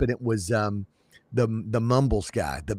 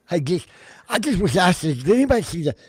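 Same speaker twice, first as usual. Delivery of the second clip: lightly voiced high Skippy; a hint of a dopey high voice; Skippy impression; 5 semitones higher than usual. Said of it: "does an impersonation of Skippy".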